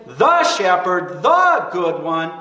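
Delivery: slow, weighty "The" before "shepherd" and before "good one"; emphatic quote. Acted. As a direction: stretch and punch each "the". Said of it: "but it's very emphatic".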